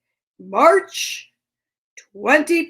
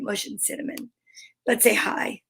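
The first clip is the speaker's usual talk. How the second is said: whisper; 4 semitones lower, 8 dB quieter than usual. Real, not acted. Hushed aside to sleeping pets.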